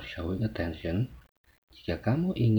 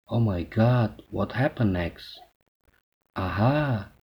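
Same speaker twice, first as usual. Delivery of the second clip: louder, animated replies to a speaker; lively listener responses; 5 dB louder, much slower than usual. Shown as a demonstration. Teacher modelling attentive replies for students, lively. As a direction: show keen interest as you answer.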